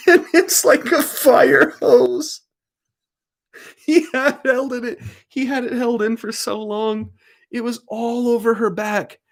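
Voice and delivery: high, slowed, half-laughing disbelief; disgusted disbelief. Real, not acted. Telling the dog story, high with disgusted disbelief. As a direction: relive the gross shock. Recounting gross dog scene.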